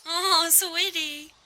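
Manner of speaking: high, slow cooing to a pet. Real, not acted. Cooing to a bird.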